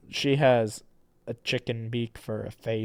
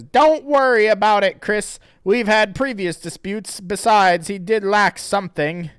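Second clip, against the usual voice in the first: character voice; 9 semitones higher, 10 dB louder than usual. Acted. Reading Sarah's lines in voice.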